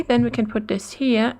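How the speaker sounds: ordinary talk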